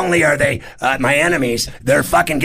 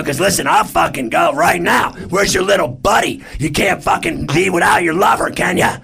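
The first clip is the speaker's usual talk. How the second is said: re-enacted heated jeer, pitched up; taunting challenge; 4 semitones higher than usual. Comic re-enacts daring his rival to fight, jeering. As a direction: goad him with mocking questions, half shouting.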